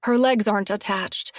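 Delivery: ordinary delivery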